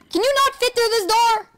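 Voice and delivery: loud exasperated question. Real, not acted. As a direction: yell in frustration at a stuck pig. Frustrated at pig.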